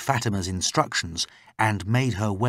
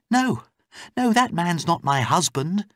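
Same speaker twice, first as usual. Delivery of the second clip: high, louder urgent denial; raised female voice, insistent; protesting captive woman; 9 semitones higher, 4 dB louder than usual. Acted. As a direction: protest quickly to set her straight.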